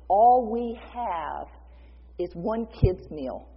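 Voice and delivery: drawn out, stressing how little; slow emphatic plea. Retold or imitated. Disciple telling Jesus the food is tiny, emphatic.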